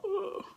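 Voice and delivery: high, soft strangled ugh; a squeezed gasp as if being strangled; choked ugh. Retold or imitated. Fan being mock-strangled by the wrestler, choked ugh. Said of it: "I'm like".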